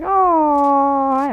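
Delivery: high, long drawn-out "aww"; gushing aww. Acted. Gamer retelling the kid's sweetness, gushing aww. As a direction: coo it up high and hold it.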